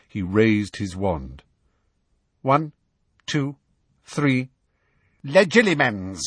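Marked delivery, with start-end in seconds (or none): 2.4-6.2 Snape casting spell. A narrator's reading.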